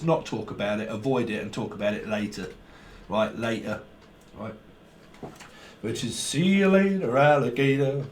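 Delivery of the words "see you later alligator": singsong rhyme. Pretend.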